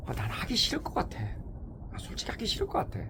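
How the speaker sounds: whispered, tired confession. Real, not acted. Fed-up man whispering to the audience, whispered. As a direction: breathe it out as if admitting a secret.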